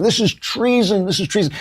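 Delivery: shrill-pitched imitation of people yelling treason; mock screaming crowd. Imitating protesters screaming at him on the left, mock yell. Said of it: "people were screaming".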